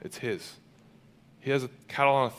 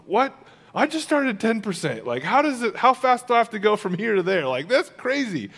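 high, incredulous retold shock; mock shock; 9 semitones higher, 4 dB louder than usual. Pastor hearing about fifty percent, in high hammed-up disbelief. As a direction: play up your disbelief. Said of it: "I'm like".

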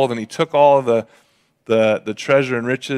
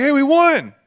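much higher triumphant call; victory cheer; 12 semitones higher, 3 dB louder than usual. Retold or imitated. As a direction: cheer the win. Army celebrating victory.

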